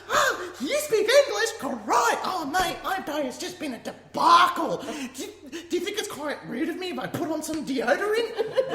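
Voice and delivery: high-pitched, excitable imitation of an Aussie woman. Traveler imitating her Aussie seatmate, excitable high voice. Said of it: "Aussie woman that hopped on the plane".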